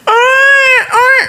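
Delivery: very high mock baby wail; short, high-pitched imitated bawls; imitated child crying. Preacher imitates a crying child, mock wailing. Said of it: "Anak nangis".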